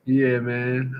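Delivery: normal talking voice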